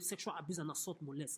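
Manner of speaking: ordinary delivery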